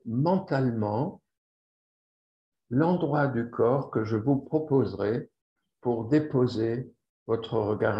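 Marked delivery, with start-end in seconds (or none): none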